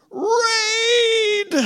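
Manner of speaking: shrill, very high cartoon bug scream; cartoon bugs panicking at the spray can; terrified bugs. Cartoon bugs screaming as the Raid can looms. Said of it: "they shudder in their six-legged boots".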